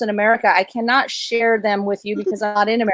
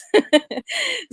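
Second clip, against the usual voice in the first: teary laugh; 13 semitones higher than usual. Real, not acted. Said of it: "before I become a mess".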